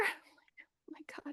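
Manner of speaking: breathless frightened whisper; scared whisper to herself. Retold or imitated. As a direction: whisper it, terrified, barely a breath. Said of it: "whispered fear at the sight".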